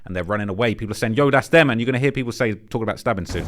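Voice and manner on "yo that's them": louder quoted call-out; raised voice on a quote; retold shout. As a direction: imitate a bystander's shout, half-full. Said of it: "hears someone shout in the background".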